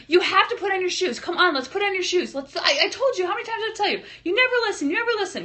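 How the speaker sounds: high exasperated mom nagging; nagging parent. Retold or imitated. Mom scolding a son over his shoes. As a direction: whine it like a fed-up parent. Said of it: "I don't say, like".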